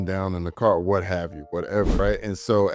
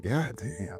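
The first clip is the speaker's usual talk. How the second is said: muttered God damn; 9 dB quieter than usual. Retold or imitated. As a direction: mutter it under your breath. Muttered reaction.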